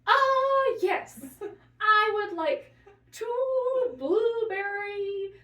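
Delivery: put-on high, loud, slow elderly-man voice; aphasia patient. Nurse retells patient ordering breakfast, high slow mock old man. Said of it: "he goes".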